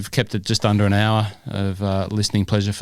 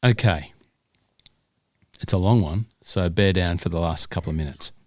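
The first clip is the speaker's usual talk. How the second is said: usual speaking voice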